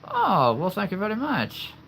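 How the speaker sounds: high, playful British thank-you; put-on British voice. Shown as a demonstration. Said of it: "that is one of my more favorite voices to do".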